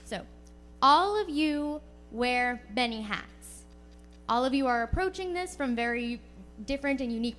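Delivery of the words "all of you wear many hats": normal talking voice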